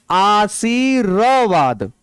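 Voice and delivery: आशीर्वाद stretched very slowly, syllable by syllable; teacher slowly dictates आशीर्वाद; slow demo. Shown as a demonstration. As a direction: sound out आशीर्वाद slowly so the र is heard before वा. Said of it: "तो पहले तो सुनो".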